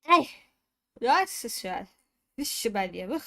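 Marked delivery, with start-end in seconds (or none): 0.1-0.3 loud sudden sneeze. Real, not acted. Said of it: "Ai, vou espirrar".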